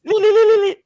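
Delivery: very high, loud li li li like a siren; siren imitation. Mimicking the siren heard in your sleep, high and loud. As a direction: sing out a wailing siren on li. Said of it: "that is called sirens".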